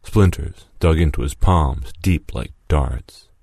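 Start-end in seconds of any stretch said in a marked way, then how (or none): none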